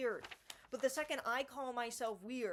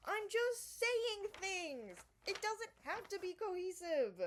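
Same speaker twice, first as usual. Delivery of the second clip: exasperated outburst; 8 semitones higher than usual. Acted. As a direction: throw up your hands and wail it. Exasperated defence of her poems.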